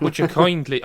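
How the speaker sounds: self-conscious attempt at a put-on accent; attempted Bioshock accent. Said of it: "couldn't do your accent".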